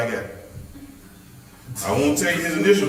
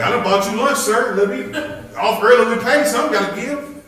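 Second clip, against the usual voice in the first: pleading worker; 9 semitones higher, 5 dB louder than usual. Retold or imitated. Worker bargaining with his boss.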